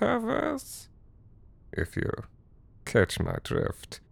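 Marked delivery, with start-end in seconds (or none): 1.7-4.0 hushed, slow menacing whisper; sinister whisper. Acted. Host hinting at worse things in a menacing whisper. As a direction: drop to a threatening murmur.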